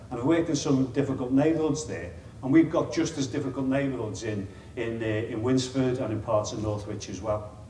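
ordinary delivery

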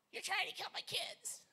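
high shrill-free mock screech of an angry mom; angry mother. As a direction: squeal it as the furious mother.

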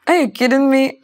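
loud, high English disbelief; incredulous. Retold or imitated. To Peter claiming the song, loud incredulous English. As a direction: throw the question back in disbelief.

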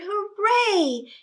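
put-on children cheering as the milk flows. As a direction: celebrate like happy kids. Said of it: "The children cheered".

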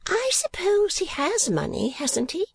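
high-voiced chattering portrait painter; Miss La Creevy. A narrator's reading. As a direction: lift into a high, prim, gossipy voice.

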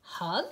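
"Hug" as a rising question; tentative "Hug", lifting at the end; asking. Acted. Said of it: "asked Douglas".

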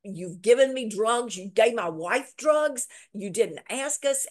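mock fury at the dentist; furious husband confronting the dentist, retold; livid. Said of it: "And John is livid".

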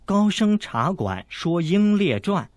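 slow careful reading of a note; reading aloud. A narrator's reading. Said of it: "念道".

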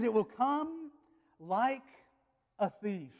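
"It will come" lifted high, pause, "like", pause, "a thief" settling down; suspenseful verse reading. Shown as a demonstration. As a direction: hang "come" up in the air before "like a thief".

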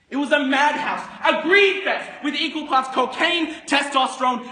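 high, very loud list of excesses; frenzied shouted narration. Acted. Broker describes his madhouse firm, high frenzied shouting. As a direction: belt it out like a madhouse roll call.

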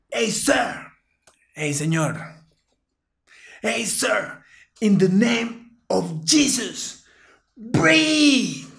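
high, slow, rising commanding plea; commanding prayer. Retold or imitated. Narrator praying over the fallen man, high and commanding. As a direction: command him to breathe in Jesus' name. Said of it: "yo le digo a ese man así".

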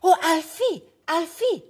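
high, loud dog character calling out; Pepper. A narrator's reading. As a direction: call a friend in a high storybook voice. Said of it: "barked Pepper".

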